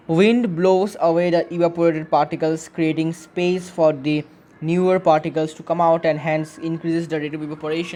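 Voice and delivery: very slow, measured reading of the answer; slow read-back. Shown as a demonstration. Teacher rereading the answer to the class, very slow. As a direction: read the note back deliberately.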